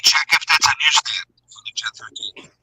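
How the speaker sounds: high pass filter. Real, not acted. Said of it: "you sound like you're in a tin can".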